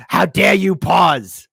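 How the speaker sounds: voicing a paused politician, hammed-up indignation; mock indignant Karen. Vlogger mocking the staring politician, slow indignant Karen voice. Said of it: "she's like".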